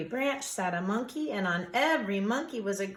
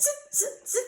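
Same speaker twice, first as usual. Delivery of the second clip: monkey chatter. A narrator's reading. Monkeys mocking back.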